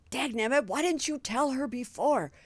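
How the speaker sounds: lightly voiced cranky Scarecrow; indignant Scarecrow. Scarecrow scolding Glinda, lightly voiced indignation. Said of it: "he's indignant, and he's like".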